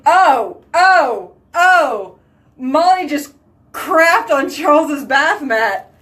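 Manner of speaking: realizing something gross; shocked discovery. Real, not acted. Discovering dog poop in bathroom.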